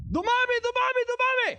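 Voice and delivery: high, loud preaching cry; rising cry. Real, not acted. Preacher crying out multiplying.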